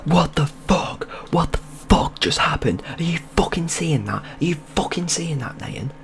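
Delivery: high, strained mock scream without full volume; retold screaming. Storyteller replays breaking the loop at his friend, high mock screaming. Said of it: "I just start screaming and go".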